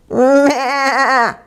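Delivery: high wavering goat bleat. A narrator's reading. Goat joining the commotion, high bleat. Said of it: "bleated Goat".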